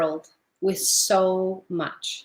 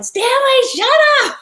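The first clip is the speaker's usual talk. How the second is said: much higher, louder yell at the pet; 8 dB louder than usual. Retold or imitated. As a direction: shout down the noisy guinea pig. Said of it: "we'd all start yelling".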